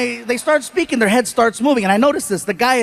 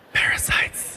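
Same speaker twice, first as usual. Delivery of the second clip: ominous whisper; 8 dB quieter than usual. Retold or imitated. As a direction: whisper the single word darkly. Ominous one-word warning.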